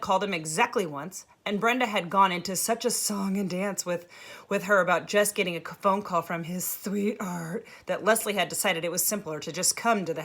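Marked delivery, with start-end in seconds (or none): none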